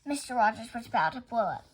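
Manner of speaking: ordinary delivery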